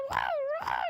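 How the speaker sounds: very high squeaky wow; high falsetto mock wow; imitating his voice. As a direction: squeak it up like the streamer. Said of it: "his voice was, like".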